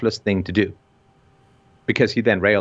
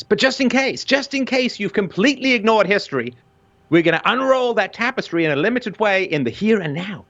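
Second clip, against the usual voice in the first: much higher, hyped trailer-voice build; dramatic announcer; 11 semitones higher than usual. Acted. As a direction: sell it like a blockbuster documentary trailer. Dramatic promo-style build-up.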